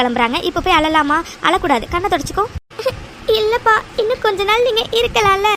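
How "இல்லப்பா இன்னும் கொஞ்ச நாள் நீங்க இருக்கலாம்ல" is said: high-pitched crying plea; tearful pleading. Acted. Daughter asking her father to stay longer, crying. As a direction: keep begging through tears, voice raised in pitch. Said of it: "என்னாச்சு அபி, ஏன் அழற".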